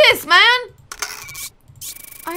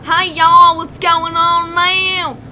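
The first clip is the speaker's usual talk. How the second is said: drawn-out country twang; hammed-up Southern US country accent; 2 semitones higher, 5 dB louder, much slower than usual. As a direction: greet folks in a slow country accent. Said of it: "That was almost country".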